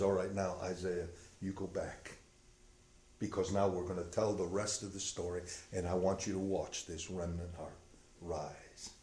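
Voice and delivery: soft, low and hushed; a hint of a solemn whisper; quiet voice of God. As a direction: speak it like a gentle command. God speaks to Isaiah.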